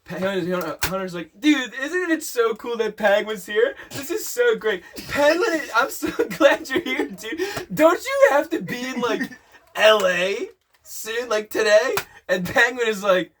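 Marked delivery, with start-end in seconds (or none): 1.4-12.0 high-pitched, excited gushing with laughter creeping in; eager, giggly mock enthusiasm; gushing friend. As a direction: play an over-keen friend, pitch jumping up. Voicing Hunter gushing at Penguin.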